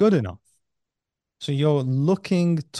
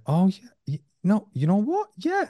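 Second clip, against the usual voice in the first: dawning agreement; 9 semitones higher than usual. Retold or imitated. Realizing, about to side with part.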